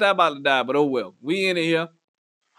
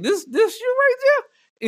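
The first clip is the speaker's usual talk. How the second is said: much higher, slow teasing question; mock high question; 14 semitones higher, much slower than usual. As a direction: squeak it out in disbelief. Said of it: "I was like".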